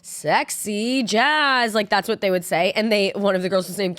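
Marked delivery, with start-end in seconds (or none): 0.0-1.7 slow, drawn-out higher announcer call; sultry stage announcer. Retold or imitated. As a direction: stretch the name out like a show emcee. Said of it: "they announced, like".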